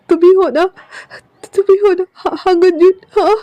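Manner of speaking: overwhelmed reunion tears; tearful relief. Acted. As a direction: cry with relief.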